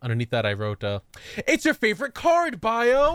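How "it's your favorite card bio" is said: high, loud mock cheer; excited shout. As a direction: yell it like a hype fan. Quoting own note, shouted.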